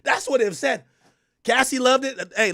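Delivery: usual speaking voice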